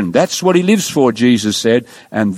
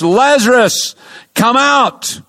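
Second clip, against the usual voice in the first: calling Lazarus out of the tomb, higher and a little louder; a raised preacher's cry, pitched high; 7 semitones higher, slower than usual. Retold or imitated. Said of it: "cried out in a loud voice".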